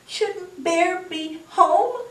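high, slow, fretful question; worried hare. A narrator's reading. Hare asks after missing Bear, high worried voice.

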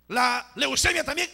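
loud, high, rising call; emphatic preacher's call. Real, not acted. Each illness called, then repeated slowly.

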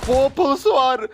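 high, slow alarmed cry. Real, not acted. Alarmed when he spots the enemy shotgun. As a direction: draw it out in shock.

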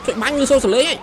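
high-pitched frantic call-outs; urgent shouting. Real, not acted. Player calling out to teammates in a shootout, urgent. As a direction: fire off repeated urgent calls, voice pitched high.

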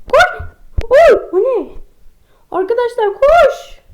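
startled high shout; panicked yelp. Real, not acted. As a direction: panic and yell. Monster appears, panic. (korkmuş çığlık)

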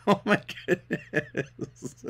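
soft, slow laugh through the words; helpless quiet chuckle, drawn out. Real, not acted. Cracking up at the duel.

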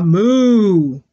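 long, loud imitated moo; drawn-out teacher's moo; cow moo. Shown as a demonstration. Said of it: "what does a cow say".

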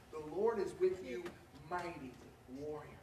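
soft, low, reverent delivery fading away; hushed angel. Acted. Angel of the Lord greeting Gideon, quiet and reverent. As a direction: let the angel's words drop to a hush.

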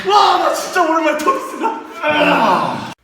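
pushing something heavy. Real, not acted. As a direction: laugh while pushing with everything. Mid-match, laughing under strain.

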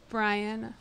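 breathy lovestruck sigh; dreamy sigh. Acted. Sighed repeat of crush's name.

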